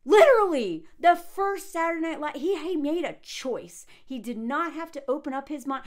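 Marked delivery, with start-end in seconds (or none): 0.1-2.6 loud, high burst on "Literally"; exasperated, raised voice; outraged emphasis. Real, not acted. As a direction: punch out "Literally" in indignation. Outraged rant about his choice.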